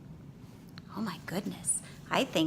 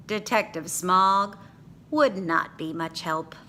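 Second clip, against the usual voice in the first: ordinary delivery, nothing marked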